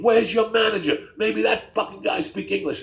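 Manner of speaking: high, loud rant of an outraged customer; mock rage. Old man berating the kid making his sandwich, raging. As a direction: act out an old man blowing up. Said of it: "in the middle of his rage".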